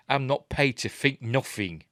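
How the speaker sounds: cheeky Cockney guard brushing off the question; Cockney soldier. Retold or imitated. Guard answering his mate about the humanoids, in mock Cockney. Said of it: "talking with Cockney accents".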